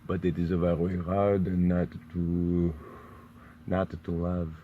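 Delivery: French accent. Shown as a demonstration. Confessing a weakness for French accents, slow French accent. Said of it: "admiration for a French accent".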